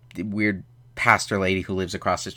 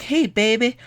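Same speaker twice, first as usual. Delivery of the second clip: put-on high, fast sing-song to a baby, much higher; baby talk; 9 semitones higher, 3 dB louder, faster than usual. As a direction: coo at the baby in a put-on sweet voice. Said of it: "she's like".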